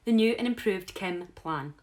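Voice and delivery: loud, slow show-host reveal; proud announcement. Acted. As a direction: announce it grandly, word by word. Announcing her new plan.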